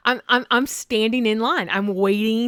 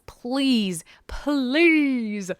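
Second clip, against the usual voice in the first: pleading; 6 semitones higher, much slower than usual. Real, not acted. Begging listeners, voice climbing.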